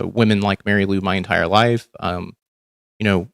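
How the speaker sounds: usual speaking voice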